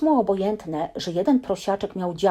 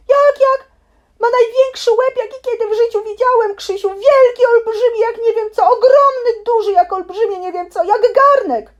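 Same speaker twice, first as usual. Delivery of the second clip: panicked babbling; 10 dB louder than usual. Acted. Piglet describing elephant in panic.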